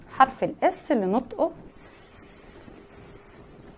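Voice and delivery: soft unvoiced hiss on س-. Shown as a demonstration. Teacher hissing س- like a snake. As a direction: breathe out a long س- like a snake. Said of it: "أنا بعمل كده ليه".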